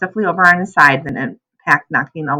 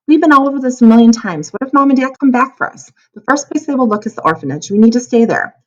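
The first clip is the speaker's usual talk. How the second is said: heated argument; 6 semitones higher, 3 dB louder than usual. Acted. Sister argues heatedly.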